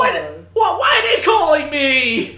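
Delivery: mock outrage. Mock outrage at work calls.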